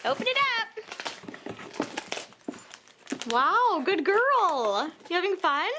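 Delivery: high sing-song voice for the dog; pet talk. Real, not acted. Talking to her puppy in high baby talk.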